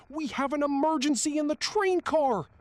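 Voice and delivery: very high, very loud desperate yell; yelling at full lungs. A narrator's reading. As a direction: yell it at the top of your lungs. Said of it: "yelling at the top of his lungs".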